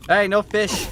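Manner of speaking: loud, high shout of no fish. Acted. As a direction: bark it out like a guard. Yelling at fish enemy.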